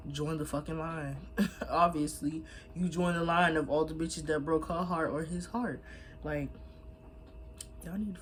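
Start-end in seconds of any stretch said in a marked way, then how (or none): none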